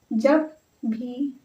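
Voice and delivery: drawn-out words at dictation speed; stretched out for note-takers; slow dictation. Real, not acted. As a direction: dictate slowly, word by word. Dictating while writing it out.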